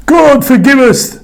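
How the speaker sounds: high, loud pleading cry; anguished cry. A narrator's reading. Sailors pleading with God as they throw Jonah overboard. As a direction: cry out for forgiveness. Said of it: "the sailors cried".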